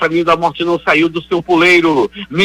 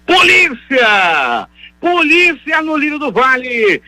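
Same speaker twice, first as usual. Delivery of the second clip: high, stretched cry for the police; theatrical call for police; 7 semitones higher, slower than usual. Acted. Radio host calling police to a robbed neighbourhood, theatrical cry. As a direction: summon the police like a town crier.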